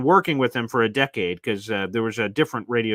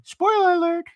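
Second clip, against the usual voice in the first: spoiler alert; 4 dB louder than usual. Acted. Playful aside mid story.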